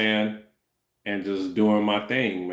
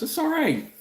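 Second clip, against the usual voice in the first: pitched way up in a squeak; squeaky high. Real, not acted. Brushing off the apology in a squeaky high voice.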